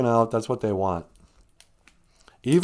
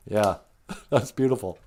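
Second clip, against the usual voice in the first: ordinary talk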